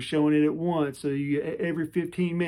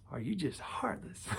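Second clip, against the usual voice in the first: whispered jab; 10 dB quieter than usual. Retold or imitated.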